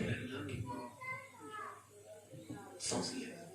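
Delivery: breathy whisper of the phrase; hushed whisper to let it sink in. Acted. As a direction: breathe the words out soft. Dwelling on the gift of breath.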